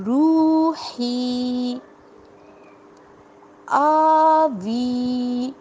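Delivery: روحی and آوی stretched very slowly; drawn out vowels. Shown as a demonstration. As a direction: hold the long vowels in recitation style. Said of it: "کھڑی زبر کو ہم ہمیشہ کھینچ کر".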